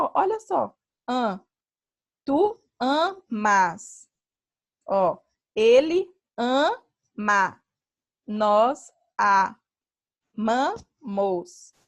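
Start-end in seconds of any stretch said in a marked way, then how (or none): 1.1-11.7 each form said very slowly and clearly; slow conjugation demo. Shown as a demonstration. Teacher to students, showing the conjugation, very slow and deliberate. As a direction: spell out each verb form slowly for the class. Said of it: "Olha, observe que".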